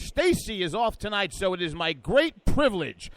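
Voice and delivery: big show-opening announcer voice, stretching the final titles; radio announcer intro. Acted.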